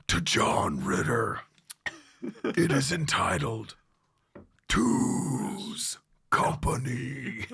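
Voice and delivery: loud, slow guttural announcement; death metal voice. Retold or imitated. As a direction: bellow the title in a drawn-out metal growl. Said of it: "death metal voice".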